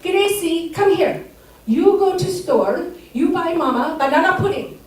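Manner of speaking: mock the mother's broken English, up high; imitating mom's accent, high and loud. Mom's accented order retold.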